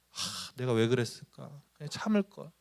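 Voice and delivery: soft, sighing self-reproach; acted regret. Person who lost their temper looks back, regretfully. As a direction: act out quietly kicking yourself afterwards.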